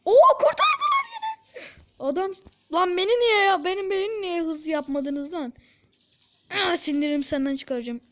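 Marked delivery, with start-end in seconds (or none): 0.0-1.6 squealed very high, slow and laughing. Real, not acted. Gamer seeing the team save it again, laughing squeal. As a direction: squeal it with delight.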